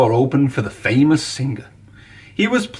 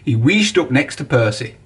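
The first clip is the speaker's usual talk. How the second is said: ordinary delivery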